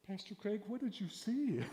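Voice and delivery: put-on high, eager child's plea; eager kid voice. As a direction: beg to know like a curious kid. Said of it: "The kids are going".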